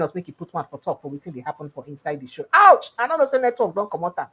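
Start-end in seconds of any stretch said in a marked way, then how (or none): 2.5-2.9 high, loud yelp of disappointment; sudden pained "ouch" at bad news; dismayed ouch. Real, not acted. Guest's network drops on air.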